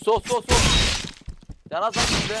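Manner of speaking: excited callout. Real, not acted. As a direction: shout the direction urgently. Urgent callouts in match.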